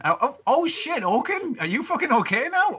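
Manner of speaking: high, breathless surprise; can't believe he's alive; high startled cry of happy shock; amazed relief. Acted. Guard amazed Oaken is back.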